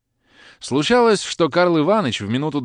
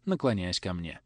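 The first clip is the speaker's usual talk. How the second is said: usual speaking voice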